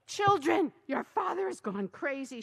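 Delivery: loud high wail. A narrator's reading. Wife wailing to the children about their father, loud. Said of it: "she wailed".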